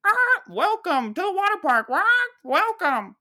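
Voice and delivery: high, loud squawking bird voice; hammed-up talking macaw greeter; animatronic macaw. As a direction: squawk a welcome like a mechanical parrot. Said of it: "A big animatronic macaw".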